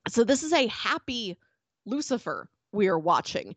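ordinary talk

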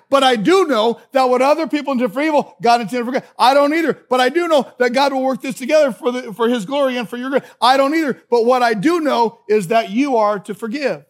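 loud, high-pitched pulpit delivery; impassioned preaching. Real, not acted. Preacher warning the congregation about complainers, impassioned and loud. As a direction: preach it out at full force, hammering each phrase.

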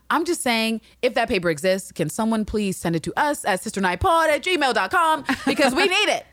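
high, emphatic begging that climbs to the end; mock desperate plea. Host begging listeners to send a paper, mock desperate. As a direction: plead with rising pitch, playfully insistent.

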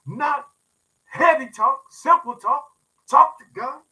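building preaching. Real, not acted.

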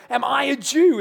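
indignant retort. Acted. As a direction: toss it back, offended. Pilate retorting to Jesus.

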